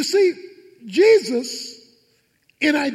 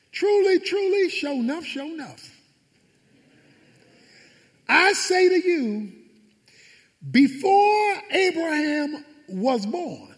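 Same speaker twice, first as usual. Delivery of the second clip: solemn proclamation; 4 semitones higher than usual. Retold or imitated. Preacher voices Jesus proclaiming.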